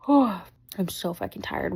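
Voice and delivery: normal talking voice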